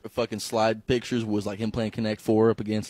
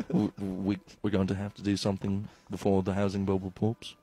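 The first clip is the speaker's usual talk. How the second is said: low, soft, earnest pundit act; mock politician; 5 semitones lower, 6 dB quieter than usual. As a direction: stammer gravely like a pretend statesman. Mock stuffy political debate voice.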